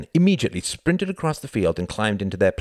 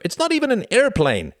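very high, loud outburst; crying out; 6 dB louder than usual. A narrator's reading. Young pilot scoffing at the glider, crying out. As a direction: pitch it right up and cry out. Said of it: "cried another".

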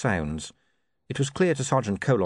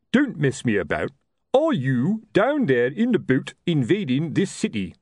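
impatient sergeant; 6 semitones higher, 3 dB louder than usual. Acted. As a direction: stop fooling, spell it out. Colon losing patience.

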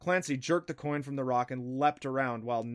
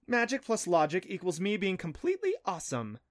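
pleased with yourself, pitch lifted; self-satisfied, higher-pitched bragging; smug; 7 semitones higher than usual. A narrator's reading. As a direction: boast it smugly. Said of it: "she said smugly".

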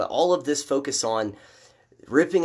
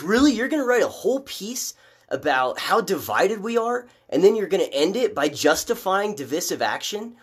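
incredulous outburst; 7 semitones higher than usual. Retold or imitated. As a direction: throw up your hands in disbelief. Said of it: "And I'm like".

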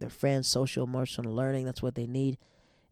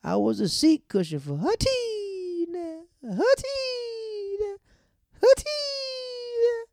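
very high, drawn-out mock wail; Tin Man; 4 dB louder, much slower than usual. Freed character recalls Teenie, hammed-up high wail. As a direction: stretch the name into a long high howl. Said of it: "It was like".

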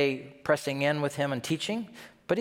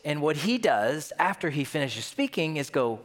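ordinary delivery

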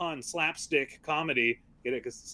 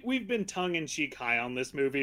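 ordinary talk, nothing marked

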